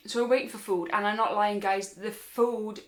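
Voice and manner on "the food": normal talking voice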